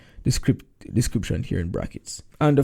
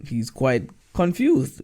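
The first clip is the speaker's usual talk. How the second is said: ordinary talk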